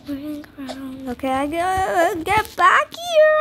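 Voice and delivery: ordinary talk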